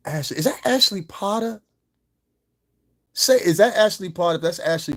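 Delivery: high, loud burst of surprised recognition; excited recognition. Real, not acted. Streamer spots a familiar name among the viewers, excited. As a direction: call out, thrilled to spot someone.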